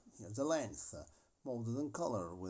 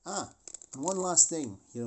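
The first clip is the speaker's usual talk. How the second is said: ordinary talk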